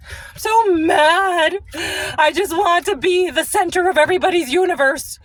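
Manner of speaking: high, whiny hammed-up wail; mock someone throwing a self-centred fit; mock tantrum. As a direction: fake crying fury, high-pitched. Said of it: "Ooh, they get so mad".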